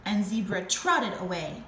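ordinary delivery, nothing marked

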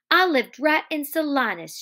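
acted heartfelt outpouring; passionate. A narrator's reading. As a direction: pitch it up, bursting to confide. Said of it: "tumbled out in a passion".

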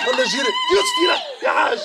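very high laughing yell; excited shout. Real, not acted. Yelling excitedly at Youssef, very high laughing shout. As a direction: shriek it with delight.

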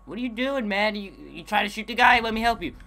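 mock taunt. Taunting an enemy in game.

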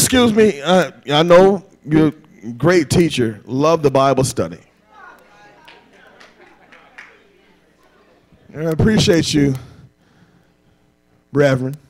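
a hint of a polite fisherman speaking up; polite Peter. Peter politely telling Jesus, lightly voiced and hesitant.

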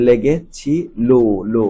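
"লেগেছিল" broken into parts, le-ge, chi, lo; standard pronunciation. Shown as a demonstration. Teacher drills pupils, "লেগেছিল" split up with final lo repeated. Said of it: "এরকম উচ্চারণ হবে".

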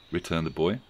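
ordinary delivery, nothing marked

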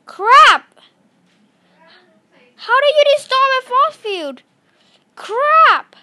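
loud, high angry yelp; frustrated shout. Real, not acted. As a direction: blurt it out in frustration. Force field destroyed.

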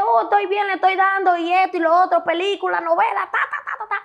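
high, loud mock-cheerful rattle; sing-song parody of her own online cheer; mocking upbeat posts. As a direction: breezily fake "I'm fine" posing.

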